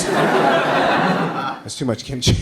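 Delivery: slow, breathy, laughing through it; laughing repeat. Retold or imitated. Comic repeating the old man's complaint through laughter. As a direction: giggle the line out.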